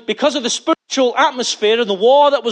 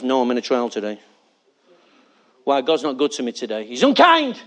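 mock sulky churchgoer; 7 semitones lower than usual.

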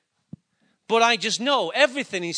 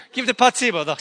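laughing through words; 5 semitones higher, 9 dB louder, faster than usual. Real, not acted. Laughing at tearful moment.

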